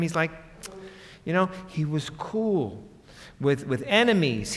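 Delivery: normal talking voice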